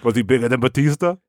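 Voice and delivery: put-on quick Boston-accented question. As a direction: ask quickly like a Boston bro. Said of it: "that has that real accent".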